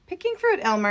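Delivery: high, chirpy monkey voice. Acted. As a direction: voice a cheeky little monkey. Monkey voiced in read story.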